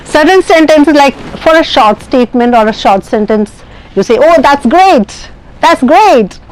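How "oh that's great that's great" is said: drawn-out, higher-pitched praise on GREAT; high intonation comment. Shown as a demonstration. Teacher showing an admiring comment, intonation high on GREAT. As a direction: lift GREAT high, then let it drop. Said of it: "the intonation goes high".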